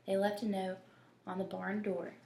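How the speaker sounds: plain reading voice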